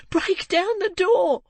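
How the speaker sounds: high, slow, loud scream; screaming boy. A narrator's reading. Boy screaming to the crowd to break in, high and slow. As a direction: scream each word at the door. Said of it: "screamed the boy".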